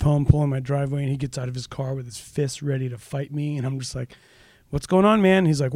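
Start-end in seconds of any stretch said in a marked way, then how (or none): 4.7-5.5 bright, higher and louder hello; breezy hey-buddy greeting to a fuming neighbor; cheery greeting. Retold or imitated. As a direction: greet him as if nothing's wrong. Friendly greeting to angry neighbor.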